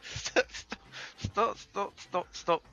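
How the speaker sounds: stammered protest, mildly upset; stuttering. Acted. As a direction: stutter out the complaint. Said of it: "making fun of how I speak".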